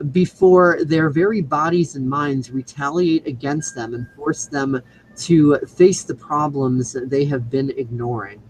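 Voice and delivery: ordinary delivery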